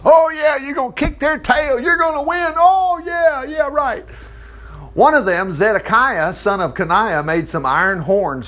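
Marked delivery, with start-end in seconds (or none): 0.0-4.0 high, hyped-up fake cheering; mock false prophets. Bad prophets promising the king a win, mock cheering. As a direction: cheer them on with sarcastic overkill. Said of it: "all the prophets were prophesying, all the bad ones".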